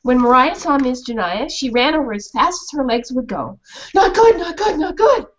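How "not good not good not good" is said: high, urgent yaps; frightened dog voice; alarmed dog. Acted. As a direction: bark the alarm.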